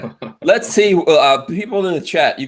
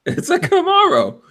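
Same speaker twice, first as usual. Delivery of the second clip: laughing. Real, not acted. Laughing at the car name.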